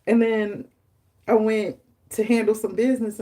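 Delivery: ordinary talk, nothing marked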